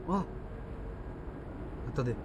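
normal talking voice

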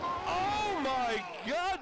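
slow, stretched out in disbelief; drawn-out stunned "oh my God"; shocked gasp. Real, not acted. Shocked reaction to big move.

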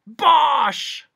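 very loud, high outburst; explosive dismissal. A narrator's reading. Humbug dismissing the bee's charge, explosive shout. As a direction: bellow it as one scornful blast.

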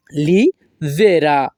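"লিবেরা" slowly, split after "লি"; syllable by syllable. Shown as a demonstration. Teacher showing the feminine form, syllable by syllable. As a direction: break "লিবেরা" into "লি", pause, "Bera".

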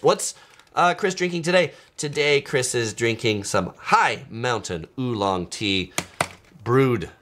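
ordinary talk

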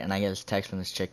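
ordinary delivery

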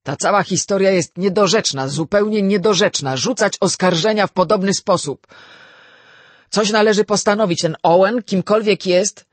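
loud, high, blustering indignation; indignant general exploding; outburst. A narrator's reading. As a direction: erupt in outrage, hammering the words. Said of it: "wybuchnął nieoczekiwanie".

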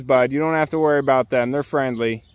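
usual speaking voice

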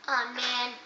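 ordinary delivery

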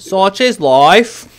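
hammed-up resigned sigh; theatrical. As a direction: savour it like a grand proverb. Joke line drawn out.

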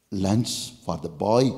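normal talking voice

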